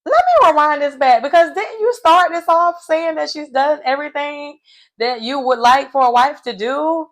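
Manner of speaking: high, loud "wait, what?"; shocked disbelief. Retold or imitated. Reacting to Jimmy's turnaround, high, loud disbelief. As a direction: replay your own stunned reaction. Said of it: "And I'm like".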